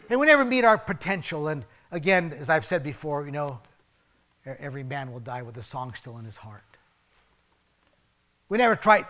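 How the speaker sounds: ordinary talk, nothing marked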